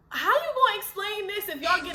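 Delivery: high, loud self-scolding; inner scolding. Retold or imitated. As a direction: yell at yourself in your head. Scolding herself inside her head.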